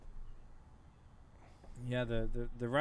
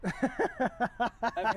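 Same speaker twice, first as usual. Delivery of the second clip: high, slow, louder mocking brag; gloating troll; 8 semitones higher, 6 dB louder, slower than usual. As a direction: ham up a smug troll boasting over champagne. Imagining gloating Russian trolls.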